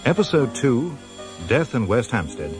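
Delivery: ordinary talk, nothing marked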